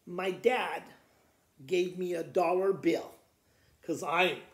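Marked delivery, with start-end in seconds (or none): none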